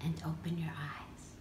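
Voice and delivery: very soft, low whisper; guided meditation. Acted. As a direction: close the meditation in a hush.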